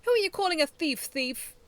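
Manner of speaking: high, loud retort; angry shout. A narrator's reading. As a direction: throw the insult back. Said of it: "Iggy shouted".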